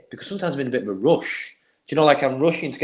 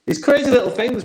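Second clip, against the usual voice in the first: excited emphasis; 10 semitones higher, 4 dB louder than usual. Real, not acted. Summing up her point.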